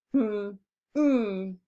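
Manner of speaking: two short hummed beats; humming the beats. Shown as a demonstration. Teacher hums out coming for the class, two short hums. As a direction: hum out the syllables. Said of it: "I've tried the humming method".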